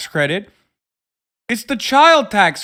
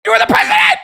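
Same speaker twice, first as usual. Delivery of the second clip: rising into a shrieking yell; incredulous shouting rant; exasperated yell; 10 semitones higher, 4 dB louder, much faster than usual. Real, not acted. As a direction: yell it in disbelief at him. Streamer yelling at speech.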